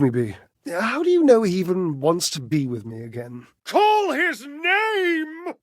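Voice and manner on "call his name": high, drawn-out pleading cry; calling out. Acted. Begging the listener to summon Washford, a long high wail. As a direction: stretch it out like a desperate summons.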